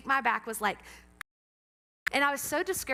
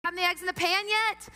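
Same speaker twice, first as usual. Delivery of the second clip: higher mock mom call; 6 semitones higher, 3 dB louder than usual. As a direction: call over to your kid from the couch. Mother calling to daughter from couch.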